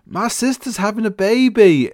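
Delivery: high, loud mock call from a bridge; excited announcement. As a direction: shout down happily to the boat below. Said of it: "the guy goes".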